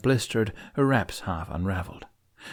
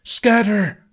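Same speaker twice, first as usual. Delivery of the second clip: much higher, much louder yell; howl; 11 semitones higher, 8 dB louder than usual. A narrator's reading. Snotlout warning the riders, howled yell. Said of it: "Snotlout howled".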